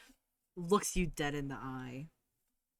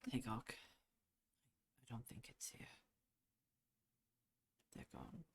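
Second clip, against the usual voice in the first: Clement speaks softly and low, softer toward the end; hushed comfort; 3 semitones lower, 13 dB quieter than usual. Acted. Clement holding Tagok, gently telling her they're gone, softly. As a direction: quietly break the bad news.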